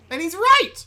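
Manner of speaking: high, very loud insistence; emphatic yell. Real, not acted. Co-host defending Usagi's plea, emphatic yelling. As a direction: shout it as vindication of the character. Vehemently backing the character.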